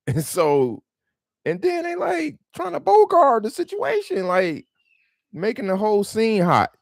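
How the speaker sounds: real exasperation pushes the voice high; a really wound-up retelling in a high voice; worked-up high voice. Real, not acted. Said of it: "Got my voice all high pitched".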